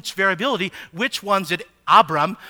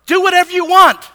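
dismissive brush-off; 10 semitones higher, 10 dB louder than usual. Acted. Abram brushing off quarreling wives.

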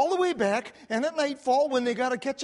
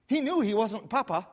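normal talking voice

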